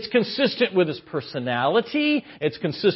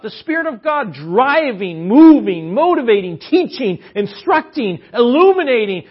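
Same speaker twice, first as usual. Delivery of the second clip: rising, louder preaching list; preacher hammering a list, louder; building preaching; 4 semitones higher, 6 dB louder than usual. Acted. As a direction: pound each word as the sermon builds.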